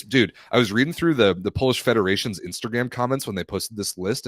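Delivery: ordinary delivery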